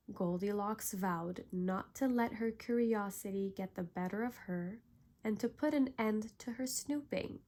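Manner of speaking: usual speaking voice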